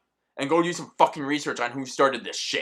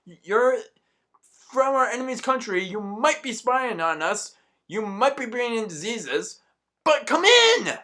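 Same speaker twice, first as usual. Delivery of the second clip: high, sing-song mock welcome; mocking naive welcome; 9 semitones higher than usual. Mocking America's open-door stance in a high sing-song. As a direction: ham up a gullible host. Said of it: "trying to be like".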